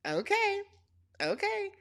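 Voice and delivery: high, soft, spaced-out okays; skeptical humoring. Retold or imitated. Recapper humoring Destiny's claim to be a character, skeptical. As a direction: humor her with small high-pitched okays.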